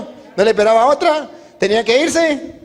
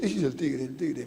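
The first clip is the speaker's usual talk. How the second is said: hushed, low, frightened murmur; soft panic, as if the tiger might hear; acted trembling fear; 5 semitones lower, 11 dB quieter than usual. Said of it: "se pone todo tembloso". (con miedo tembloroso, en voz baja)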